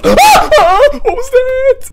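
very high, loud, stretched-out shriek; startled scream. Real, not acted. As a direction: scream the question in fright. Jump scare in horror game.

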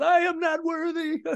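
high mock-worshipful fan voice; Wayne's World bow. Guitarist joking about meeting his hero, high mock worship. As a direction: grovel playfully like Wayne before a rock idol. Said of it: "do the Wayne's World".

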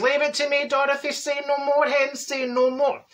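hammed-up confident promise; breezy self-assurance. Confidently taking on the job.

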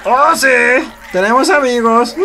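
high, loud happy yell; squealing with joy; excited cheer. Real, not acted. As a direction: celebrate winning new friends. Celebrating new friends.

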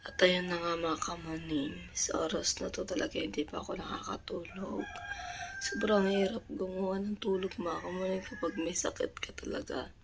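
soft late-night whisper; hushed so others sleep. Real, not acted. (pabulong na pagsasalita)

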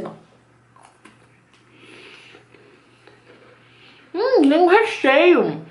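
talking while chewing; biting then chewing cookie; mouth full of cookie. Real, not acted. Seen 5: Bites the cookie at 0.3, chews visibly through about 5.7.

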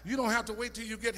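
loud, high, rising sermon cry; preaching at full force. Acted. Preacher shouting joy and peace to the congregation, full force. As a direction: preach it hard and let it ring.

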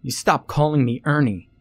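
high, loud outburst; shouted, voice cracking. A narrator's reading. Ernest objects to the nickname, shouting. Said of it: "Ernest shouted, his voice cracking".

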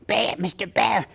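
unfinished cartoon duck attempt; practising duck voice. Boy keeps practising with his recorder, rough duck attempt. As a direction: try out a duck voice, not quite getting it. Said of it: "I knew I could do it".

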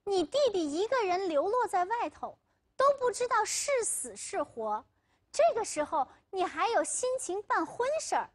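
high-pitched indignant parental scolding; narrated parents' anger, high and reproachful; angry. A narrator's reading. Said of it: "他爸妈生气地说".

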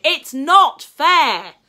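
high, very loud, drawn-out yell; angry shout. A narrator's reading. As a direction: stomp off, shouting at the world. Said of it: "shouting angrily".

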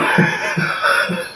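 soft breathless laughter; breathy laugh. Real, not acted. Host cracking up at his own joke, breathless laughter. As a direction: wheeze with laughter.

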